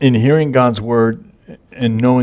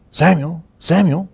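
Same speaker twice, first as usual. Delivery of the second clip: higher, carrying call of the name; 7 semitones higher than usual. Retold or imitated. God calling the sleeping boy Samuel, a high carrying call. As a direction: call the boy's name from afar, lifted high.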